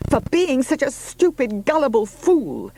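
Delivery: Juno's high, loud angry shout. A narrator's reading. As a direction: raise the voice, furious at herself. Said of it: "She had raised her voice to shout".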